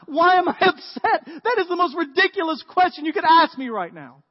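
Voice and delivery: distraught outburst. Retold or imitated. Mary to the disciples at the empty tomb, loud distraught outcry. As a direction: shout it out, grief turned to fury. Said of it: "She looks at the guys like".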